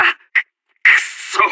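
a slow, halting, voiceless curse through the teeth; strained whispered curse. Acted. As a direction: choke out the curse as the line collapses. Commander curses as the position falls. (悔しさを押し殺した、かすれたささやき声の悪態)

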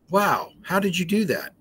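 put-on high, amazed wow to a child; light up as if truly impressed; enthusiastic praise. Shown as a demonstration. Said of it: "you can say".

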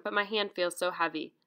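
plain reading voice

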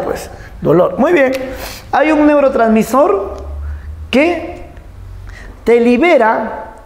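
ordinary talk, nothing marked

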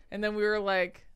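ordinary talk

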